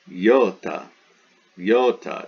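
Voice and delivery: "iota" said "YO-ta", opening on a y sound; two-syllable iota. Shown as a demonstration. Teacher models the word iota for students, two-syllable "yo-ta". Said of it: "It's two, it's not iota".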